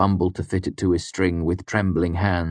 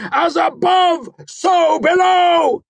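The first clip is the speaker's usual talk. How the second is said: slow, drawn-out yell, much higher and louder; triumphant shout; 7 dB louder, much slower than usual. A narrator's reading. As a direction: roar the binding aloud. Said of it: "I shouted".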